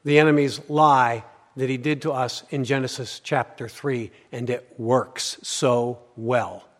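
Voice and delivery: loud, driving preacher's voice; full-force preaching. Acted. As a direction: preach it hard to the congregation.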